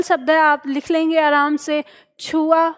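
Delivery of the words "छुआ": ordinary talk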